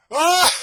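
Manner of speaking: grand éclat de rire aigu et fort; éclate de rire de tout ton cœur, bras ouverts. Shown as a demonstration. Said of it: "Je vous montre". (démonstration d'un éclat de rire très fort)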